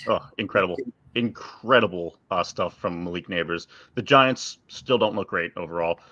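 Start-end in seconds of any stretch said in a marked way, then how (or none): none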